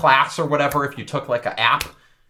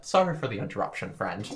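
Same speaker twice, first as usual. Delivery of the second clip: softer, falling polite aside; hushed faux-polite sorry; soft mock apology; 6 semitones lower, 10 dB quieter than usual. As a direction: tack on a quiet apology. Apology after outburst.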